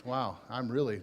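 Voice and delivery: ordinary delivery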